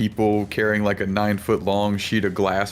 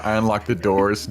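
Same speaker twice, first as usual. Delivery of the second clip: usual speaking voice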